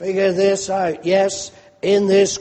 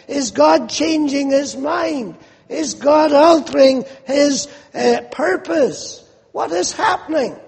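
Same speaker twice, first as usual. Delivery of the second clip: bewildered questioning; 6 semitones higher than usual. Acted. Peter wondering if God changed.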